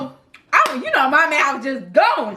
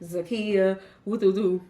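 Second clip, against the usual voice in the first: put-on calm boyfriend; 6 semitones lower, 5 dB quieter than usual. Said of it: "he's the calm one".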